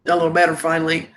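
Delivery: ordinary talk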